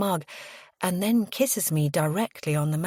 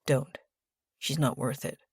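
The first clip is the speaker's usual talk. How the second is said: soft, low murmured caution; understated confidential warning; whispered warning; 4 semitones lower, 5 dB quieter than usual. A narrator's reading. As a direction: quietly hold them back. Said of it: "whispers into my ear".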